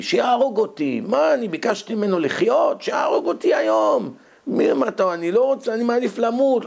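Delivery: high-pitched shouted despair, rising to a yell; despairing outburst. Retold or imitated. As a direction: cry out that you would rather die, building up. Despairing man raging about his life. (צעקה נואשת בקול גבוה ההולכת ומתגברת)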